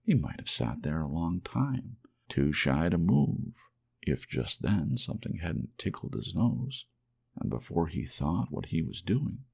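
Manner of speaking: plain reading voice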